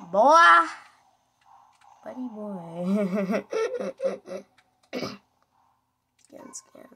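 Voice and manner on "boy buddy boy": mock taunt. Real, not acted. Taunting the losing enemy.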